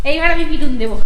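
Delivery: hammed-up parent threat; mock scolding. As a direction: pretend to be cross, half-laughing.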